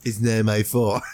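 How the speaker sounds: laughing. Real, not acted. Laughing denial.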